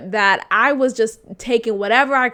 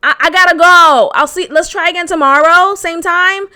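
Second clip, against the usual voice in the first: higher, louder exasperated telling-off; exasperated rant; 4 semitones higher, 6 dB louder than usual. Retold or imitated. Girlfriend telling boyfriend she must go, fed up. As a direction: lay it out loud and fed up. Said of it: "paint the pictures to him".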